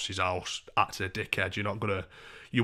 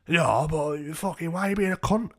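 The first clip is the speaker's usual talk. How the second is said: put-on aggrieved drunk woman; 7 semitones higher, 4 dB louder than usual. Woman ushered downstairs challenging him, aggrieved. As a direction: imitate her whining challenge, pitched up.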